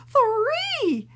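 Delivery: high loud cry; pained shout. Acted. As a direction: yelp the count as you're hit. Boinked teacher cries out.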